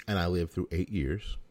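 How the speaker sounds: usual speaking voice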